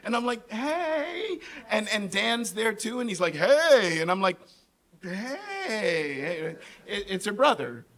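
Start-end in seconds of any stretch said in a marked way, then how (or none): 0.5-1.4 answering greeting. Retold or imitated. His answering greeting to Shelley. 3.4-4.0 loud, higher mock hello; hearty greeting. Acts out Dan's greeting. 5.0-6.6 long, wavering stretched-out hello; uneasy greeting. Retold or imitated. His wary reply to unexpected Dan.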